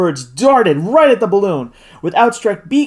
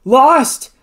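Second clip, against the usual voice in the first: loud, higher cry of despair; one anguished, loud word; despairing exclamation; 8 semitones higher, 6 dB louder than usual. A narrator's reading. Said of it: "exclaimed the sportsman with a gesture of despair".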